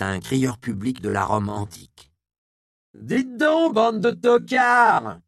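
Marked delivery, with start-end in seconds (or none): 2.9-5.2 put-on high, loud hoarse old woman's shout, slowing; hoarse powerful yell. A narrator's reading. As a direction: yell down the crowd like a town crier. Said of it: "hurla-t-elle de sa voix rauque mais puissante". (la vieille Isia hurle à la foule, voix rauque et puissante)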